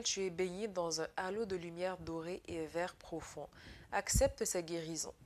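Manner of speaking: soft, gentle reading of a message; soft card reading. Acted. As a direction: read the angels' words softly. Reads the angels' card message.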